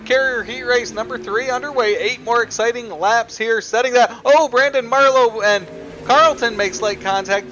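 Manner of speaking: excited race call, rising pitch; building excitement over the contact; motorsport commentator. Real, not acted.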